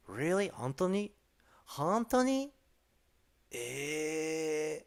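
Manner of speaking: high fake shock; mock astonishment. Streamer miming a fake listener's shock in Japanese. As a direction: overplay how surprised you are.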